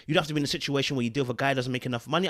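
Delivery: fast, rushed restatement of the point; rapid-fire repeat. Shown as a demonstration. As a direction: rattle it off quickly to drive it home. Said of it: "repeats the point much faster".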